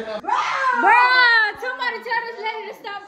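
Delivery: exasperated shout. Real, not acted. Joking complaint about cleaning.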